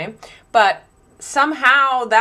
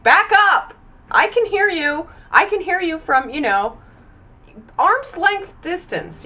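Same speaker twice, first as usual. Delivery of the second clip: exasperated yell; 8 semitones higher than usual. Retold or imitated. Yelling at close talkers.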